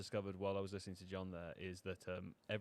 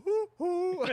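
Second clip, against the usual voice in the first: excited whoop; 11 dB louder than usual. Real, not acted. Cheering a goal.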